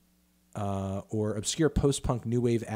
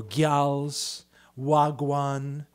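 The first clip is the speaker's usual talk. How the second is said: slow, put-on Jamaican lilt; mock Jamaican patois; 3 semitones higher, 5 dB louder, much slower than usual. Listing [name]'s borrowed slang, mock Jamaican accent. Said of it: "[name] singing about tings".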